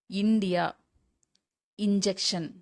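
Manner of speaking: "இந்தியா", "இன்ஜெக்ஷன்" opening on "i"; ஈ sound demo. Shown as a demonstration. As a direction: model "இந்தியா" and "இன்ஜெக்ஷன்" singly, clear "i" first. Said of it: "இது வந்து ஈ". ("இந்தியா", "இன்ஜெக்ஷன்" ஈ ஒலியில் தொடங்கி)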